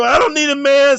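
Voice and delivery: mocking women who claim they need no man. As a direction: sneer it like a parody. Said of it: "Like women go".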